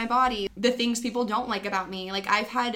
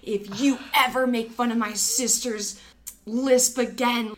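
a hint of a tough big brother; tough big brother threat; 3 semitones higher, 5 dB louder than usual. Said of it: "I think he said something like".

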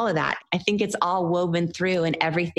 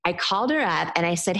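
usual speaking voice

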